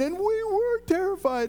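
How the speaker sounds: very high, strained-up retelling of fright; acted terror. Women recalling the angel in the tomb, acted terror. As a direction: play the frightened witness, pitch soaring.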